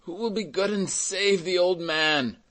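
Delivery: high, pleading cry; crying out for help. A narrator's reading. Old carter begging the bystanders, crying out. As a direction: plead aloud to anyone who might come. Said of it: "cried old Fauchelevent".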